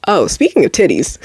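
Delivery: normal talking voice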